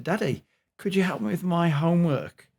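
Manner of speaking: a hint of a child asking Daddy for help; older daughter. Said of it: "His older daughter said".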